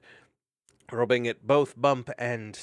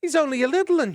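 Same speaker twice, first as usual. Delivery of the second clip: high, loud anguished wail; 11 dB louder, faster than usual. Acted. Mariel over the stunned hedgehog, wailing. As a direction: cry out in dismay. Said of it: "she wailed".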